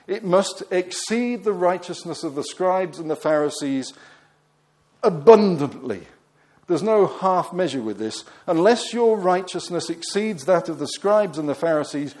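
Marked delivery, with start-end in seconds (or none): 5.0-6.1 abundantly punched loud; abundantly thrown out as the emphatic climax. Shown as a demonstration. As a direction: land hard on abundantly, each syllable weighted. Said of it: "the word that he uses there for exceed is emphatic".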